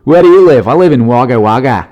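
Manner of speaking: punchy put-on Aussie accent; Australian accent. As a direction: play a proud Australian local, accent laid on. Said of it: "in Australia with that accent".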